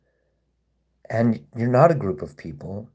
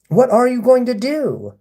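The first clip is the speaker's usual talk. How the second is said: pitched way up, goading; high baiting taunt; 9 semitones higher, 3 dB louder than usual. Real, not acted. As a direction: push it higher to provoke. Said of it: "I'm baiting you right now".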